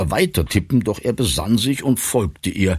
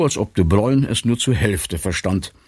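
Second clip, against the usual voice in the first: plain reading voice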